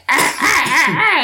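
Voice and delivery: high, loud grunts mimicking the jingle; imitated grunting. Mother imitating her son grunting along to an ad. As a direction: grunt the tune like a kid in the back seat. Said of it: "but grunting".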